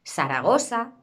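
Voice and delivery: "Zaragoza" with s for both z, Saragosa; seseo. Shown as a demonstration. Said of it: "la S".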